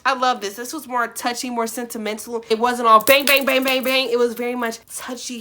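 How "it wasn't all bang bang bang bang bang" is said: mock emphasis. Real, not acted. Mocking rough content.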